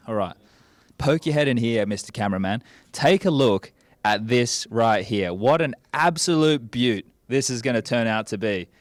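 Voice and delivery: ordinary delivery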